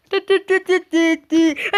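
loud, slow ti-ti-ti sound effect; mock drumroll sound; vocal tension beat. Real, not acted. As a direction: tap out suspense with your voice. Imitated sound while jumping.

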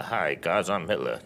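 low, soft, understated delivery; low end of range. Shown as a demonstration. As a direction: say it small and deep, deadpan demo. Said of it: "this is my range as an actor".

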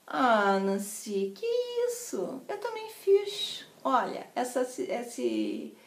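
put-on soft, slow Rio accent; gentle carioca. Friend confides about her own scar, gentle carioca accent. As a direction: imitate a soothing, lilting carioca manner. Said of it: "ela com aquele jeitinho que eu tenho morro de inveja".

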